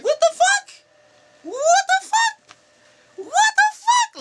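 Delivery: very high, loud falsetto squeal; shocked disbelief. Retold or imitated. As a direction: squeal it in drawn-out, hammed-up disbelief. Acts out shock at being fired.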